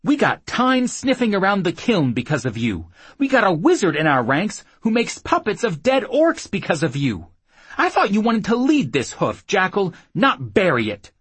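loud, high furious scolding; angry tirade. Acted. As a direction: tear into him. Woman berating man in a fight.

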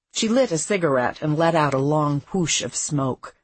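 ordinary delivery, nothing marked

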